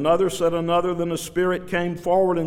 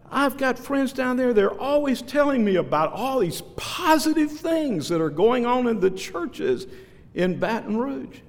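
a higher, put-on voice for the woman; mimicking the complaining woman; 8 semitones higher than usual. Preacher voicing a woman who calls him negative. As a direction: lightly play the offended churchgoer.

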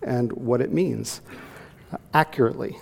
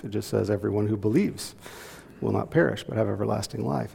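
ordinary talk, nothing marked

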